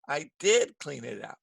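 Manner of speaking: firm weight on "did"; "did" held and leaned on, the rest falling; stress on DID. Shown as a demonstration. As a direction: correct them with an emphasized "did". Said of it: "It's for emphasis".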